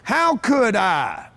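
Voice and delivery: drawn-out "How could I"; slow emphatic delivery. Shown as a demonstration. Coach repeating the key question to the room, slow and deliberate. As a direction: let each word of "How could I" sink in.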